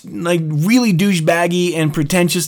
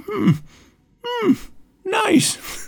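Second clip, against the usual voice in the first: high, soft mock-impressed old lady; a hint of a doddering granny; imitating old lady; 5 dB quieter, much slower than usual. Old lady staring at his tattoo, high mock-impressed murmur. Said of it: "she's like".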